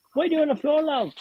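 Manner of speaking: a hint of a kindly British nurse; British accent. Nurse to the patient on the floor, mild British accent. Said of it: "That's a fantastic British accent there".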